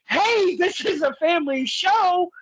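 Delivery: high-pitched, drawn-out heckle from the crowd; mock-scandalized yell, pitched well up; retold yell. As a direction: call it out high like a fan yelling at the ring. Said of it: "boy, oh boy, I yell".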